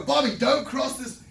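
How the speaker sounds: loud, slow, urgent parent's cry; dramatic warning. Acted. Parent to child as a car comes, dramatic shout. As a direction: stop the kid with a big acted shout. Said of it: "I know that's a bit dramatic".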